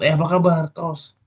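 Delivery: bright, high, loud greeting; cheerful hello. Retold or imitated. Hungry mosque caretaker greeting a friend with forced cheer. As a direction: greet your friend with a big cheerful lift. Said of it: "Jadi menyapa teman".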